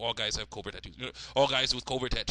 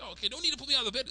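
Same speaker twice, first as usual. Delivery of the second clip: put-on fast, high girl voice; Candace; 12 semitones higher, much faster than usual. Girl found hiding under bed, fast high surrender. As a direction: rattle it off in a squeaky put-on voice. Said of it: "So it's like".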